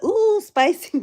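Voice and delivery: high, excited ooh spicy; squealed ooh spicy. Retold or imitated. Streamer rereads her chat comment after the judge's clapback, squealing with delight.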